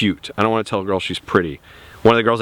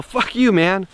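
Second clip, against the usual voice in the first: playful, much higher Fuck you, man between buddies; teasing his buddies with a much higher Fuck you, man; joking fuck you; 9 semitones higher, slower than usual. Shown as a demonstration. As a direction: toss Fuck you, man off as a friendly jab. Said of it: "hanging out with my buddies and we're joking around".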